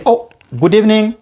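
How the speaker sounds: high, bright "Oh, good evening"; cheerful greeting demo. Shown as a demonstration. Teacher acting a cheerful evening greeting. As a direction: greet a friend warmly, lifting the "Oh". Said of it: "We say".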